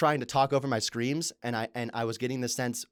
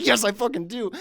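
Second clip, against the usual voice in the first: higher, louder defiant retort; defiant answer to a friend's challenge; defiant yes; 10 semitones higher, 6 dB louder than usual. Retold or imitated. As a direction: slam it back at him. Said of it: "I was like".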